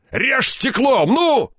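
high-pitched urgent command; impatient shout. A narrator's reading. Степаныч orders cutting with glass, shouting impatiently. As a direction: push him with a shout.

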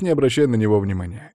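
plain reading voice

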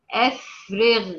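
أفرغ with a lightly heavy غ, slow; relative emphasis. Shown as a demonstration. Teacher demonstrating the غ after a kasra. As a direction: draw out أفرغ with a half-heavy غ. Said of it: "ساكنة بعد كسر".

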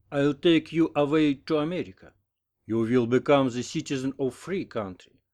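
slow, ardent wooing in English; enamoured American suitor. A narrator's reading. American proposes to his nurse, slow ardent courting. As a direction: propose slowly, with grand feeling.

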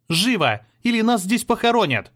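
high, loud urgent yell; shouting. A narrator's reading. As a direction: drive everyone on with a shout. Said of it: "кричала Ева".

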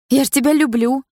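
high, loud anxious plea; desperate girlfriend's high appeal; pleading declaration. Acted. Girlfriend pleads to keep boyfriend.

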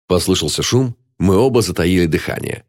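plain reading voice

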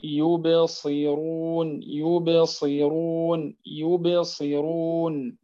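يبصرون drawn out slowly, the ب gently bounced; minor qalqala on ب. Shown as a demonstration. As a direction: repeat يبصرون slowly, tapping the ب. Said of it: "واضح؟". (قلقلة صغرى للباء في يبصرون)

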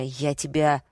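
plain reading voice

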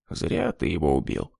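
low, soft remark; quiet. A narrator's reading. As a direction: say it quietly to him alone. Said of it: "тихо проговорил". (тихо)

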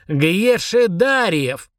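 very slow, spelled-out name; syllable by syllable. A narrator's reading. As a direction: split Гьешедариев into slow syllables. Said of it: "повторил по слогам Фурундарок".

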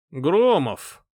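loud, drawn-out hail; proclaiming. A narrator's reading. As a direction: herald the name grandly. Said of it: "возвестил Сирт".

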